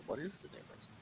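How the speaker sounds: quiet mumbled question; student muttering over the phone, quiet; muttering to self. Retold or imitated. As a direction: mutter it under your breath at the phone. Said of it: "with your phone going".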